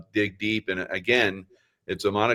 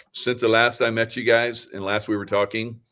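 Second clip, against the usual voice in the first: ordinary talk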